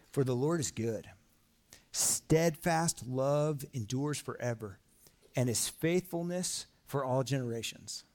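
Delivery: slow, solemn reading of the psalm; scripture reading. Acted. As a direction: read each line slowly, like a declaration. Reads psalm aloud to congregation.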